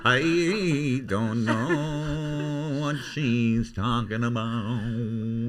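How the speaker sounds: put-on high, laughing Kerry accent; mock Kerry lilt with a laugh. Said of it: "But do Kerry accent".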